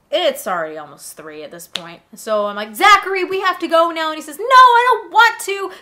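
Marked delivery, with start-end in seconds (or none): 2.7-4.1 exasperated sister order. Retold or imitated. 4.4-5.7 loud, high, drawn-out refusal; slow hammed-up childish whine; defiant little brother.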